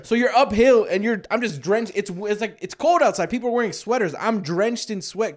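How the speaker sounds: loud, high exasperated ranting; frustrated rant. Retold or imitated. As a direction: vent at full volume. Angry rant about humid uphill.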